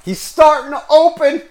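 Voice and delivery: squeaky, loud teasing exclamation; mock high voice. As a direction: tease yourself in a squeaky voice. Mocking himself opening up.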